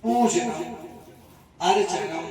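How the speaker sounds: measured, word-by-word Sanskrit intoning; ritual sankalp recitation. Acted. Priest reciting the sankalp for the devotee in measured intoning. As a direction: intone each phrase of the vow with pauses between.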